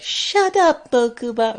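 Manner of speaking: very high cartoon boy shout; squeaky high-pitched anime boy; Yugi voice. Shown as a demonstration. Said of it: "Yugi's iconic voice".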